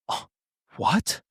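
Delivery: gasped "oh", pause, then soft "what?"; hushed recoil of disbelief; shocked. Shown as a demonstration. Coach demonstrating a shocking thing, shocked recoil. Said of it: "Like a shocking thing".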